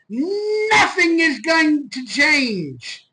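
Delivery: NOTHING high and long drawn out; slow, hammered declaration with a drawn-out NOTHING; emphatic NOTHING. Real, not acted. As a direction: stretch "nothing" out high, then slide down slowly. Emphatic rant to deluded fans.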